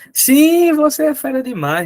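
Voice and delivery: excited praise. Real, not acted. Praising student Carol.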